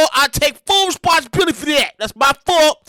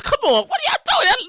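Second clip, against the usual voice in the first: ordinary talk, nothing marked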